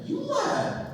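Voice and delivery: very high-pitched, incredulous mock protest; indignant accusation. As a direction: protest in a high voice, slowing down to preach the rule. Retold indignant comeback to the boy.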